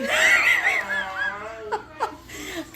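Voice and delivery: long shrieking giggle; high cackling laugh. Real, not acted. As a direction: crack up at your own joke. Cackling after boom shakalaka joke.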